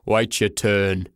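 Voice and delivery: slow, gruff porter; surly, drawn-out dismissal; deep growl. Acted. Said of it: "he growled in a deep voice".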